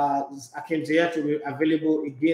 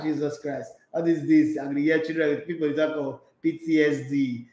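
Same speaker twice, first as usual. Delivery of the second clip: usual speaking voice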